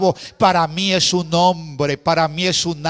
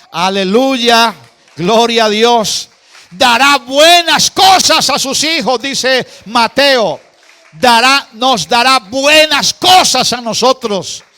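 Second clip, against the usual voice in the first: louder and higher, proclaiming with full force; fervent preaching; 5 semitones higher, 6 dB louder than usual. Acted. Pastor to his congregation, fervent full-force preaching. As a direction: preach it out to the whole church.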